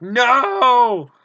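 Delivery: a loud high "No!" as the ball falls onto the field; dismayed cry. Retold or imitated. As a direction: cry out as the ball drops away. Baseball rolls onto field.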